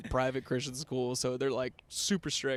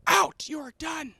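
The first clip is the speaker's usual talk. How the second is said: much higher, slow mock teacher yell; teacher's angry dismissal; 3 dB louder, slower than usual. Art teacher banning a student, high drawn-out angry order. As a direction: draw out each word as an imitated banishment. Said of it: "she was like".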